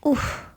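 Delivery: sharp high winded oof; knocked breathless by a pillow hit. A narrator's reading. Girl hit in the tummy, high winded oof. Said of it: "I said".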